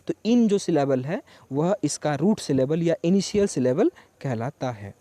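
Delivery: ordinary delivery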